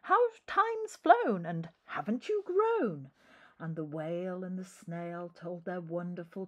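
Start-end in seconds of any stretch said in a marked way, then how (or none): 0.0-3.1 a lightly voiced, high chattering flock; a hint of fussing old birds. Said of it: "who said".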